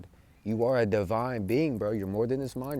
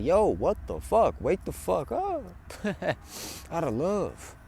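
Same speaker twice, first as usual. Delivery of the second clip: higher-pitched, slowed mock outburst of Mother Earth; raging Mother Earth; 7 semitones higher, slower than usual. Voicing Mother Earth scolding humanity, mock angry and high. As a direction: voice an angry planet, half-joking, then laugh. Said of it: "she's kinda, like, raging".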